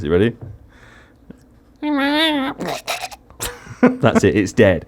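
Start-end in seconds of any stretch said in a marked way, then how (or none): 1.8-3.1 high squawking imitated eagle cry; eagle noise. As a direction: screech like a struggling eagle. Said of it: "this is an eagle trying to get to Mount Doom".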